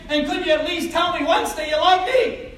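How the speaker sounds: high, loud, nagging wife's demand. Acted. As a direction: badger him right in his face. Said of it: "his wife is in one ear".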